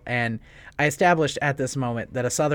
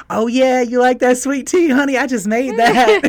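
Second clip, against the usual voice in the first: sweet Southern waitress; 10 semitones higher, 9 dB louder than usual. Retold or imitated. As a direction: coo it like a doting old Southern lady serving her sweet tea. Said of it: "the way she said it".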